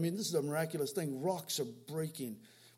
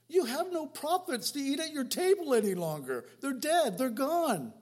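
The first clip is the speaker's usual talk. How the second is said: heated taunt; 7 semitones higher, 4 dB louder than usual. Acted. Mocking Jezebel's threat, heated.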